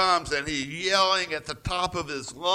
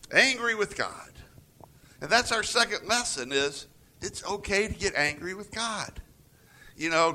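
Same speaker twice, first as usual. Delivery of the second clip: usual speaking voice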